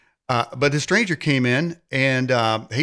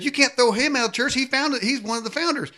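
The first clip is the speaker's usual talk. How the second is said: indignant protest; 10 semitones higher than usual. Retold or imitated. Couple protests in church.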